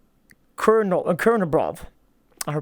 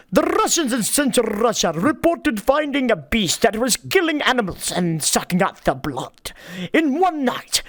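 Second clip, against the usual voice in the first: hammed-up Indian accent, R's trilled; Indian-accented reading with rolled R's; 3 semitones higher than usual. Shown as a demonstration. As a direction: put on a lilting Indian accent. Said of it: "I was doing the Indian accent".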